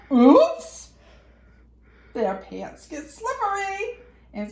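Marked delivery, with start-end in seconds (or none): 0.1-0.9 loud, bright oops; mock surprise. A narrator's reading. Reader to children as pants slip, loud oops. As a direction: act the sudden slip, loud.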